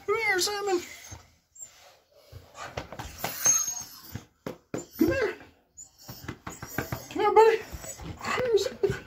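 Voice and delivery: very high, soft doggy talk; coaxing the dog. Real, not acted. Owner getting the dog ready for a picture, high and coaxing. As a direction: call the pet in a tiny high voice.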